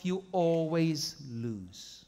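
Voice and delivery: you always lose, slowed and weighted; slow emphatic punchline. Acted. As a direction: land it slowly so it sticks. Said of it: "Get that tattoo somewhere safe".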